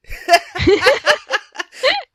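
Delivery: loud, high real laugh; burst of laughter. Real, not acted. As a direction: laugh at your own embarrassment. Laughs at nobody caring.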